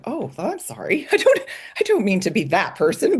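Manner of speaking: embarrassed laughing sorry; laughing apology. Retold or imitated. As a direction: laugh it off sheepishly.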